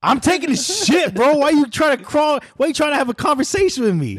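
high-pitched, pleading outrage; exasperated mock protest. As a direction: protest in a squeaky voice at an intruding kid. Acting out yelling at the kid.

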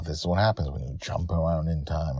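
ordinary delivery, nothing marked

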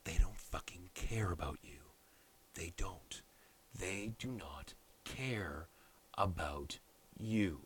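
drops to a near whisper, slow and pointed; hushed intensity. Real, not acted. Telling viewers the parties don't care, hushed. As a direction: lean in and hiss it low.

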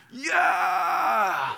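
drawn-out high-pitched yell, falling at the end; celebrating fan cheer. Acted. Fan reacts to team scoring, high celebratory yell.

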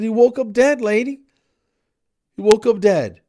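loud, high, exasperated yell; outraged retort. Retold or imitated. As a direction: throw it back at the caller, incredulous and shouting. Angry retort to the vet's caller.

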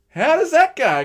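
high, loud, baffled cry; exasperated shout. Retold or imitated. Preacher voicing bafflement at the odd route home, shouting. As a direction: throw the question up in disbelief. Same puzzled question, shouted.

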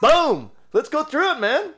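high, loud burst of hype; pumped-up. Retold or imitated. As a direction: fire yourself up to charge in. Launching into recording.

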